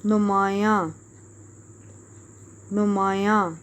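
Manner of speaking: نمایاں said with a nasal ending, the final n barely touched; نمایاں ending in a nasal vowel, noon ghunna. Shown as a demonstration. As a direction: dictate نمایاں slowly, letting the end go nasal. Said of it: "آخر میں نون غنہ کی sound آئے گی".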